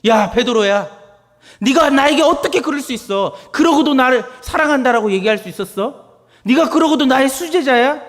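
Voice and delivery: high, loud, reproachful rebuke; Jesus voiced rebuking Peter in anger. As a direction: scold a betraying disciple. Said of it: "이렇게 책망하고 분노의 눈빛이었을까요".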